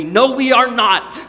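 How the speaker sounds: high, loud, drawn-out refusal; emphatic. Acted. Preacher voicing the Bible's answer to progress, emphatic. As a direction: hammer out each word emphatically. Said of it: "says emphatically".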